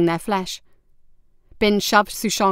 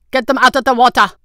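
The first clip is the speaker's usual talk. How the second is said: loud, raised-pitch command; barked order; 5 semitones higher, 8 dB louder, faster than usual. A narrator's reading. Guard captain ordering men to haul intruders out, barked shout. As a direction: bark it out as an officer. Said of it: "the guard captain ordered".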